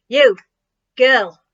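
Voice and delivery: loud, brusque shout; shouted command. A narrator's reading. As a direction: shout it out at her like an order. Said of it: "shouted to her".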